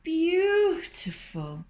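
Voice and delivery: high, slow, sighing fox; sighed. Acted. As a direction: breathe it out in mock rapture, high. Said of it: "he sighed".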